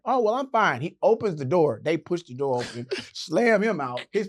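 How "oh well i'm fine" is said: higher, louder proper-voice imitation; breezy mock-proper reply. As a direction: reassure them in a put-on polite voice.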